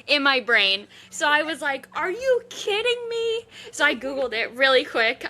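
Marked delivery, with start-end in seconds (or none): 2.0-3.5 high, incredulous outburst. Retold or imitated. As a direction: demand it in disbelief, way up high. Retelling her outrage.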